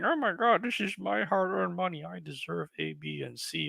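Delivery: mocking a buyer who demands perfection; mock whiny buyer. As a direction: mimic an entitled complainer.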